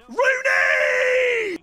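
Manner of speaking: long, high drawn-out footballer-name yell; playground shout. Shown as a demonstration. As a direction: scream it like a kid kicking a ball. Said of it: "what name do you shout".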